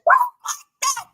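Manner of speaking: mock-frustrated high-pitched squeal. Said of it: "I get so frustrated".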